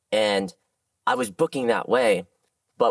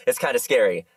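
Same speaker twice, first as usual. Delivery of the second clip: normal talking voice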